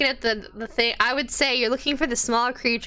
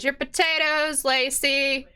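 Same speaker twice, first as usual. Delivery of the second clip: cook calling out; 6 semitones higher, slower than usual. Acted. Cook announces potatoes to barmaid.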